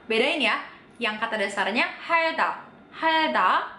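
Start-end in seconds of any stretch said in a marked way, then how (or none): none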